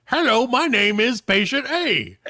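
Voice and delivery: high, slow hammed-up puppet voice; mock puppet voice. Nurse voicing a patient as a puppet, high and slow. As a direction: make a vagina talk like a ventriloquist dummy. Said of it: "quote, pulled her labia apart and said".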